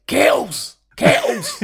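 very high, strained mock yell; high-pitched impression of a man yelling a name; imitating Coltrane's shout. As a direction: squeal the name out like a comic bellow. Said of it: "it's the way he says it".

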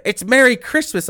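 mock a self-righteous shopper; a religious customer correcting the cashier, huffy mock insistence; indignant zealot.

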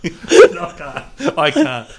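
squealing with laughter; can't get it out for laughing. Real, not acted. Cracking up over the bit.

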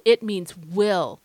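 "will" said slow and loud; stress on will. Real, not acted. Host insisting that God means it, heavy emphasis on "will". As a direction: land hard on "will", drawing the phrase out. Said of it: "I'm gonna put emphasis on that word will".

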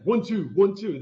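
loud mock trainer count; calling out punches. Boxing owner calls punch count to Rob, loud mock shout. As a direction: bark the combo like a boxing coach.

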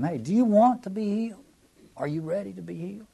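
high, probing question; Jesus challenging the man. A narrator's reading. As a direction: press him on whether he wants it.

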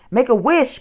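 high, loud, pressing plea; urgent cry. A narrator's reading. Girl urges brother to wish them away, urgent cry. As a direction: cry out a desperate instruction. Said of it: "cried Annie".

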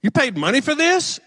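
high, disbelieving jab at the record; who would pay for this; incredulous. Retold or imitated. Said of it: "he looks at me and he says".

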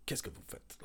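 whispered guard's challenge; gruff guard. Retold or imitated. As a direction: hiss the question like a night guard. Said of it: "And he was like".

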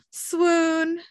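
high, dreamy gush; hammed-up lovestruck sigh of a word; mock swoon. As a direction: swoon over a new crush. Charlie gushes at the fairy.

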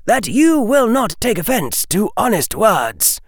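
high-pitched, stern elder voice; old witch. A narrator's reading. Old witch addressing Harry about Minerva's opinion, high stern voice. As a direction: speak as a formidable witch, pitch well up. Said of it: "the old witch said".

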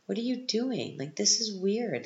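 high, incredulous protest at the movie; exasperated retort. Retold or imitated. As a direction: pitch it up in disbelief, like scolding the characters. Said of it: "And I'm like".

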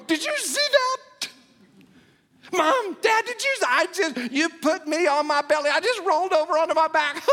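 put-on a proud baby showing off to mom and dad, with a giggle; excited baby voice. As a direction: toddler crowing about rolling over.

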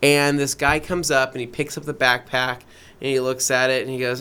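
usual speaking voice

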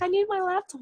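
a high, mock-needy request; mock whiny plea. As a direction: plead for the laptop like a sulky kid. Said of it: "He's like".

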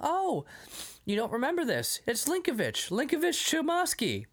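high, eager mock-innocent patter; improvised cover story. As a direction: sell a made-up name to parents. Son improvises lie to parents.

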